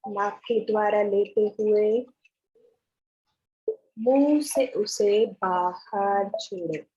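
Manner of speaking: slow meditation guide, long pauses between phrases; guided meditation. Acted. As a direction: guide the breathing slowly, drawing out the words.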